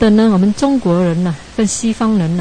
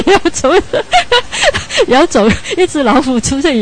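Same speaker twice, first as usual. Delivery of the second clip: high, real laughter breaking into the words; laughing mid-sentence; 9 semitones higher, 4 dB louder than usual. Real, not acted. Teacher amused by his own tiger story, laughing. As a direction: crack up while telling it, pitch jumping up.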